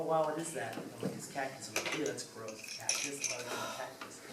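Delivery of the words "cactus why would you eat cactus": normal talking voice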